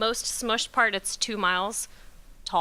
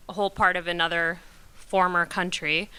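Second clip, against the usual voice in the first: ordinary delivery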